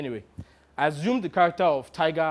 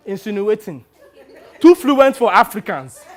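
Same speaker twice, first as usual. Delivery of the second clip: raised, louder disbelief at the end; incredulous echo; 5 semitones higher, 9 dB louder than usual. Retold or imitated. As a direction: repeat it back, scandalised. Actor appalled by the director's remark.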